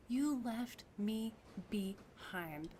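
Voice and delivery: slow, drawn-out, hurt; wounded repeat. Acted. Wu repeating the accusation to Duncan, slow and wounded. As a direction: let the accusation break into hurt.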